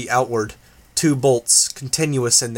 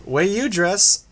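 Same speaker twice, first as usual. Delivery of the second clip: Grace's taunt; 7 semitones higher, slower than usual. A narrator's reading.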